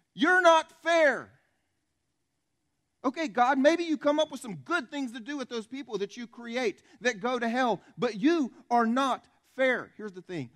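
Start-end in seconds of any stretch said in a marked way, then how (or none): 0.1-1.3 loud, high, drawn-out protest; indignant accusation. Acted. People answering God back, indignant slow shout. You know what our answer is. 3.0-9.9 loud, high railing at God; indignant argument. Acted. People arguing with God over hell, indignant and loud.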